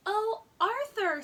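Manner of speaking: a mother despairing at bad manners; exasperated mother. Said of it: "said his mother".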